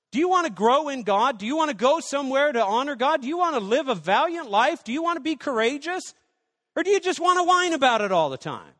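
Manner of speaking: high, loud rising questions; full-force preaching. Acted. Preacher challenging the church, high and loud. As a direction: challenge the congregation.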